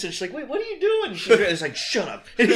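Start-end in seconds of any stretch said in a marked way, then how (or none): 0.3-1.1 put-on much higher, startled girl's question; imitated alarm. Girl on her stomach to star, mock alarmed. 1.8-2.3 dismissive retort with a laugh in it; mildly angry, imitated. Star hushing the girl, mildly mock angry. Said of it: "just gets angry about it".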